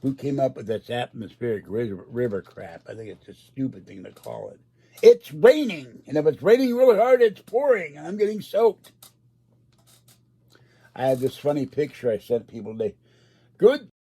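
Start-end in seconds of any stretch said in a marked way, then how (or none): 5.0-8.9 high, loud indignant rant; loud, high-pitched exasperation; exasperated outburst. Acted. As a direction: cry it out as if stating the obvious. Exasperated rant at the weather term.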